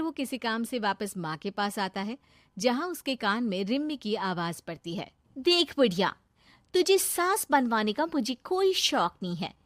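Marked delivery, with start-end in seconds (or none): 5.4-9.5 lightly voiced, contemptuous schemer; scornful gold-digger character. Girlfriend admits to boyfriend's mother she wants his money, scornful. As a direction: show the old woman open disdain.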